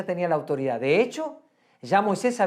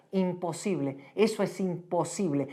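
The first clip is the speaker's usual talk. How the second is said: mock doubting voice; 2 semitones lower, 7 dB quieter than usual. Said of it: "nos grita".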